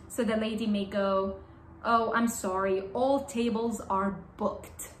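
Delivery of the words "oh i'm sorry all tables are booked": usual speaking voice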